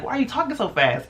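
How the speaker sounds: mock scolding, pitched well up; a scolding mom, voiced high; high mock-parent question; imitating mom. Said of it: "My mom was like".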